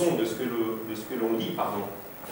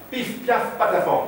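squealed, popping sound poetry in a much higher voice; onomatopoeia performance; 11 semitones higher than usual. Shown as a demonstration. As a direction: fire off nonsense noises in rapid percussive volleys. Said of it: "ça donne ça".

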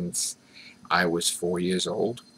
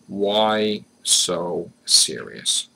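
very slow "Why so serious", each word stretched; loud, heavily slowed "Why so serious"; drawn-out repeat; 5 dB louder, much slower than usual. Shown as a demonstration. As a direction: draw out every word, pausing between them. Repeats question drawn out for effect.

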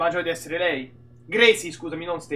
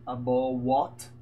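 lightly acted confusion, low and softer; mock puzzled, rising question; baffled listener; 8 semitones lower, 5 dB quieter, much slower than usual. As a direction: query it as if you misheard. Acts baffled listener in British skit.

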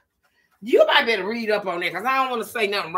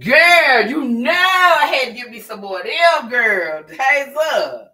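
excited; 3 semitones higher, 8 dB louder, slower than usual. Real, not acted. Excited about the food.

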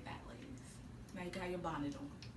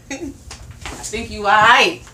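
slow drawn out; 12 dB louder, much slower than usual. Real, not acted. Teasing reassurance, drawn out.

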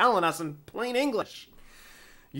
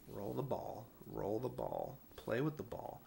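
much softer murmured mantra to oneself; muttered self-calming; 5 semitones lower, 14 dB quieter than usual. Retold or imitated. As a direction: mutter it under your breath to stay calm. Self-calming with stress ball.

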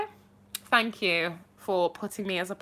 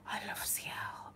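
breathless whisper; 14 dB quieter than usual. Real, not acted. Streamer thrilled by a song request, whispering breathlessly. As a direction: gasp then whispered adoration.

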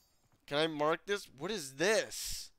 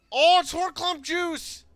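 excited shout; 13 semitones higher, 10 dB louder than usual. Real, not acted. Excited at a found item.

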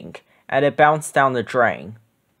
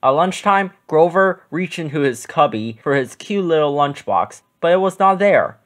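plain reading voice

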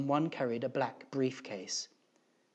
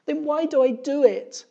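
very high, loud despairing cry; high-pitched, loud exasperation; exasperated teacher; 12 dB louder than usual. A narrator's reading. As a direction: cry out like a teacher at the end of her rope. Teacher despairs of her job.

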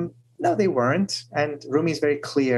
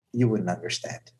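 knowing reply; 9 semitones lower, 6 dB quieter than usual. Retold or imitated. Shams quietly returns Rumi's own brush-off, low and soft. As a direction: lower and softer, a calm turnabout. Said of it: "he turns around the "you wouldn't understand".

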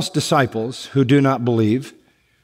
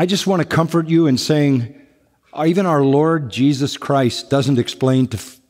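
ordinary talk, nothing marked

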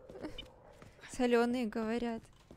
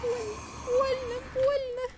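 crying in pain; 9 semitones higher than usual. Real, not acted. Hit by monster.

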